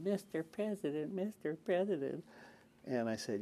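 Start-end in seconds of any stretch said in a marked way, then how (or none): 0.0-2.2 high, whiny mock plea from the press pack; whiny reporters. Senator mocking reporters calling after the president, whiny and high. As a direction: mimic nagging reporters, pitched up and whining. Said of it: "you stand out there whiny".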